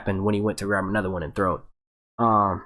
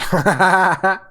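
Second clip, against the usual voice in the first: loud laugh; 6 semitones higher, 6 dB louder than usual. Real, not acted. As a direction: burst of real laughter. Laughs at a joke.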